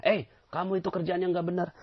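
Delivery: lightly voiced angry boss; mock scolding boss.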